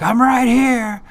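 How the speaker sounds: high, loud, drawn-out holler; imitating a tiny guy's long yell; imitated yelling. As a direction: holler across the jail, stretching the words. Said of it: "he yells at him".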